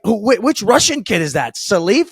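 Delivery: high questioning lilt, played for laughs; retold baffled snap. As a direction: imitate a buddy who has completely misheard. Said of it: "he just snaps and turns to me".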